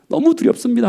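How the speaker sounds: Jesus in Gethsemane pleading with the Father, anguished; anguished prayer. Retold or imitated. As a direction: cry out to the Father in fear. Voicing Jesus praying in Gethsemane.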